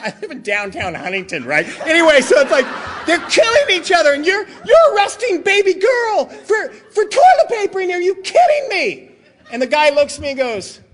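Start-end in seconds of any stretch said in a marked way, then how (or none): 1.9-9.1 loud, high, indignant tirade; indignant rant. Retold or imitated. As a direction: rail at the detective, voice climbing. Ranting at detective about injustice.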